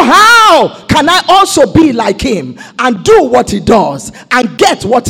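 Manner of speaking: loud, measured sermon build; fervent preaching. Real, not acted. Preacher to congregation, building toward triumph loudly. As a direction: declare each line with force.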